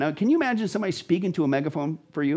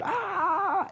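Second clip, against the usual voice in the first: high, drawn-out mock scream, held back in volume; thin high "aah" of someone being killed; imitated scream; 3 dB quieter than usual. Host acts out a victim being butchered, high mock scream. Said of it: "a person's being violently butchered".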